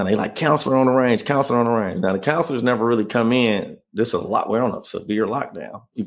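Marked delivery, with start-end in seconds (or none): 0.3-2.0 calling out down the tier. Retold or imitated. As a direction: shout it down the range like a guard. Guards announcing counselor arrival.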